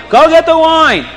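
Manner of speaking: high, loud yell of a crowd. Acted. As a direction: call out impatiently. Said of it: "everybody is yelling".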